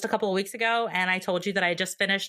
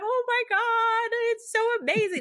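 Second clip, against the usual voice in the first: gushing delight. Retold or imitated. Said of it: "And I was just like".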